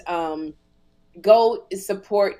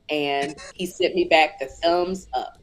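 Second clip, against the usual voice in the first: usual speaking voice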